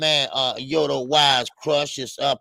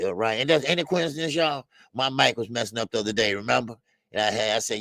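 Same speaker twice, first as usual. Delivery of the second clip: normal talking voice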